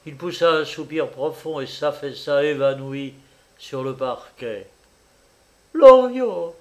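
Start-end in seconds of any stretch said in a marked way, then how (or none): 5.7-6.6 loud, high, desperate call; old mother's piercing-pitched cry. A narrator's reading. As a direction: shout your son's name in alarm. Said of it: "criait la vieille".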